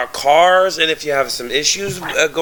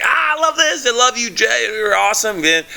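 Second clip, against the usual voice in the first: high-pitched, excited retold gushing; gushing fan; 8 semitones higher than usual. Fan typing a lot to drummer on livestream, gushing. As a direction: gush like an overexcited fan. Retells his gushing stream comments.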